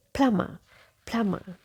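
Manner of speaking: "plumber" as PLUM-uh, no B; correct plumber. Shown as a demonstration. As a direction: model "plumber" with silent B and R. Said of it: "So the correct pronunciation is".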